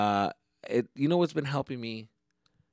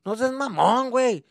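much higher and louder scolding Mexican dad in Spanish; dad's voice; 12 semitones higher, 9 dB louder than usual. Retold or imitated. Dad scolding son over YouTube and video games, mocking angry rant. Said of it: "My dad called me talking shit".

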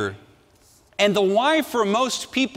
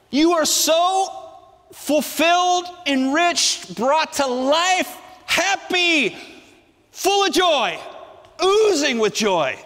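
higher, gushing, overflowing with joy; excited; 7 semitones higher, slower than usual. Acted. Preacher to congregation, describing makarios with gushing excitement. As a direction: let the joy spill over each word.

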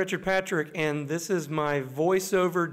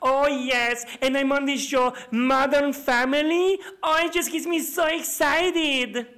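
put-on Colombian accent, a little higher; Sofia Vergara; 5 semitones higher than usual. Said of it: "it's me, Sofia Vergara".

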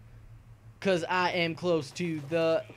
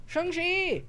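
mock enemy shout; 4 dB louder than usual.

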